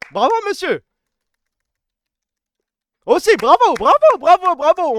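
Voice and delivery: high, loud mocking applause; sneering cheer at the one who deleted the evidence; sarcastic bravo. Real, not acted. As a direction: applaud him with heavy sarcasm.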